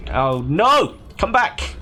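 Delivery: real startled shout after a fleeing character; startled cry. Real, not acted. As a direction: call after it in alarm. Game character runs off.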